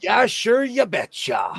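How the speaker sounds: a high, bouncy Minnesota "Yeah, sure, you betcha"; quoting Jack O'Neill in a Minnesota accent. Retold or imitated. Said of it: "Jack O'Neill is from Chicago".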